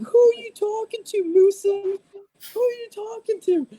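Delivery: very high, squealing put-on voice; mock irate corporal. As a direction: imitate a corporal challenging a soldier in falsetto. Imitating a corporal berating a soldier.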